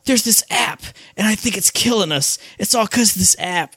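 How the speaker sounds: high, overdramatic horror-trailer voice; mocking a cheesy teen horror line; mock trailer actor. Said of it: "embarrassing if you watch the trailers".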